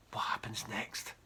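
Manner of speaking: hushed teaser whisper. Acted. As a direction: breathe it as a cliffhanger. Teasing cliffhanger.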